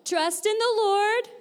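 teacher urging a bigger echo. Shown as a demonstration. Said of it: "let's say it a little louder".

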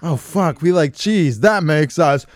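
high, loud, booming ogre voice. Acted. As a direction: boom it out like a dim ogre. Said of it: "my loud ogre voice".

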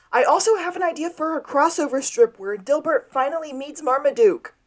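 excited, high-pitched mock holler; mock scream. Sean screams his crossover strip idea, high mock scream. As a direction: imitate someone screaming out a bright idea, high. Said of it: "Sean screamed".

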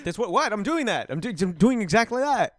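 high, insistent dude voice; whiny bro insisting he's doing it; frat bro. Retold or imitated. Iceman acted insisting, higher.

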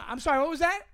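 loud, high challenge; fuming confronting the delivery guy; confrontational. Retold or imitated. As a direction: demand it back, fuming. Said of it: "And I go".

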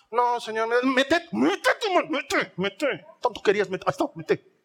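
mock exasperated jab, pitch jumping; sarcastic impatient Jesus. Jesus mockingly pushing doubting Thomas, exasperated sarcasm. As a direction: snap at him like a fed-up teacher.

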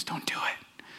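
unvoiced whisper in the ear; sly whispered temptation; devil whispering. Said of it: "He's whispering in your ear".

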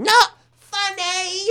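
high, drawn-out mock shout; retold heckle shout. Woman shouting across the room at the comic, mock shout. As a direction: stretch it into a long, high-pitched heckle. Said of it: "She's shouting".

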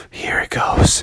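hushed, breath-only delivery; tense whispered moment; suspenseful whisper. Acted. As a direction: whisper it before the big throw. Last try, tense moment.

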